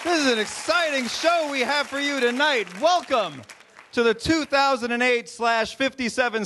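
high, hyped-up host greeting; rally the crowd after a big opening number; excited show opening. Real, not acted.

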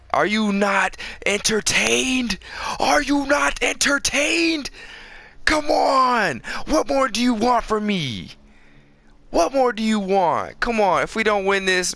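high, slow showboating shout; triumphant bellow. Acted. Gamer demanding applause after his kills, triumphant shout. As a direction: roar it to the crowd like a champion.

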